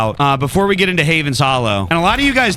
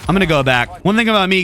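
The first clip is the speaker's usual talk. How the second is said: ordinary talk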